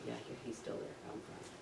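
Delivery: very soft, low murmur; hushed self-reassurance. Retold or imitated. Diver touching unseen buddy, hushed relief. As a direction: check and reassure yourself under your breath.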